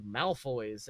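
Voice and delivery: low, soft growl of contempt. Acted. Mother answering her son about rich families, a low growl. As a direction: spit the name out low. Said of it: "And she just growled".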